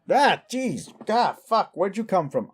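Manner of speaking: high, startled outburst; startled yelp. Acted. Startled by someone already present.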